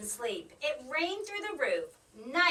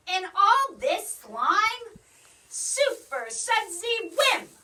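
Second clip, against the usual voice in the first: loud protest; 7 semitones higher, 7 dB louder, slower than usual. Acted. Mitzi disgusted, then spell.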